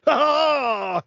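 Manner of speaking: high, drawn-out mock "oh"; exaggerated stunned wail; imitated reaction gasp. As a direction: imitate an overblown reaction to a gift. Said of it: "You know how Dave reacts".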